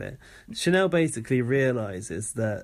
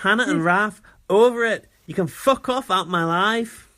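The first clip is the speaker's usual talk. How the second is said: put-on loud, high Scottish accent; 6 semitones higher, 6 dB louder than usual. Imitating Andrew to a friend, loud Scottish accent. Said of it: "Oh, the Andrew accent's gone".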